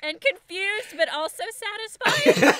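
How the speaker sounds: high, loud, laughing questions; real helpless laughter after the joke; laughing disbelief. Real, not acted. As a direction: gasp it out through laughter. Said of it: "I'm so ashamed".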